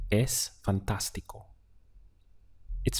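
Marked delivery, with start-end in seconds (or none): none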